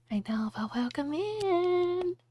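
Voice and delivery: breathy unvoiced whisper. Whispering a welcome to the plush toy. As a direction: hush it like a secret.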